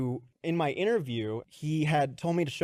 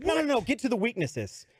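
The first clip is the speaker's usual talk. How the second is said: impatient boss; 9 semitones higher than usual. Retold or imitated. Impatient boss voiced in story.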